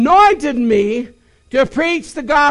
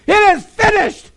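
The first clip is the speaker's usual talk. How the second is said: high, loud declaration of victory; triumphant proclamation; 7 semitones higher, 3 dB louder than usual. Acted. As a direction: announce it like a victory.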